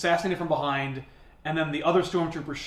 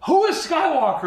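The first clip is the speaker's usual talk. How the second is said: high, loud mock demand; acted angry demand; 12 semitones higher, 5 dB louder than usual. Woman storming back into the bar, acted angry demand. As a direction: fire the question out high and loud.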